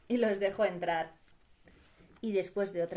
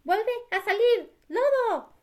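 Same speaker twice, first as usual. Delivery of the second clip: high, slow lamb voice; high cartoon little sheep; 10 semitones higher, 4 dB louder, slower than usual. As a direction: call the wolf in a tiny high voice.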